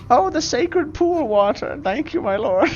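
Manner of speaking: high, reverent gushing; awestruck worshipper. Acted. Worshipper splashed with sacred water, awestruck. Overawed worshipper thanks the god.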